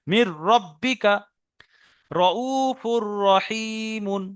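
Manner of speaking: মির রব্বিকা রউফুর রহিমুন slowly, long vowels held; recitation example. Shown as a demonstration. Teacher giving students an example, slow drawn-out recitation. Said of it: "যেমন".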